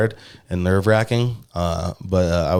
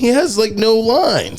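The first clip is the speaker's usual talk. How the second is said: squeaky outraged complaint, much higher; high incredulous whine; 13 semitones higher, slower than usual. Real, not acted. Complaining to his friend about a part with no lines, high outraged whine. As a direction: pitch up in disbelief at the tiny part.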